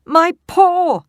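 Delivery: high loud pained yelp. Acted. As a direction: cry out as your paw is crushed. Wolf yelps.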